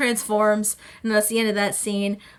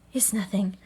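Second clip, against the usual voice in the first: soft, understated reply; hushed quoted aside; retold brush-off; 3 semitones lower, 7 dB quieter than usual. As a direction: quietly voice a character waving it off. Acts character brushing off concern.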